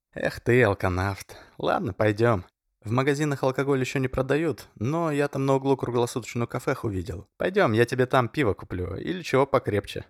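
friendly, teasing kindness; good-natured. Acted. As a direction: say it kindly, amused. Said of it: "добродушно сказал".

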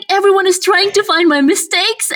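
usual speaking voice

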